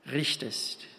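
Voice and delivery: ordinary talk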